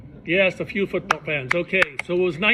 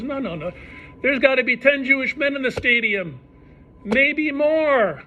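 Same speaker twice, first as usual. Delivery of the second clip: sure of himself, pushing the point; insisting; 8 semitones higher than usual. Retold or imitated. Yankel insisting in stadium.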